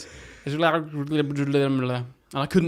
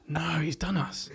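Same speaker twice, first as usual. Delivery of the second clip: whispered disbelief; 7 dB quieter than usual. Retold or imitated. Kids whispering conspiratorially.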